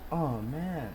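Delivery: usual speaking voice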